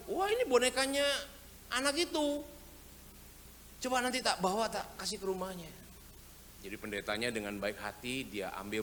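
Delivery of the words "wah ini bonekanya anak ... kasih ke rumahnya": high, lightly voiced kind pastor; lightly played gentle pastor; kindly pastor. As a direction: sound pleased and kind as you recognise it. Pastor recognising the child's doll.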